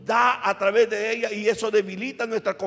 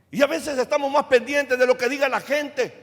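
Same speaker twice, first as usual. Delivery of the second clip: usual speaking voice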